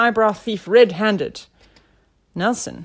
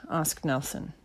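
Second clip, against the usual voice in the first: ordinary delivery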